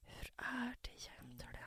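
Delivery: slow, hushed caring question; gentle whisper. Retold or imitated. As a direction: whisper it like a worried friend. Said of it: "som när någon bara". (viskande omtänksam fråga)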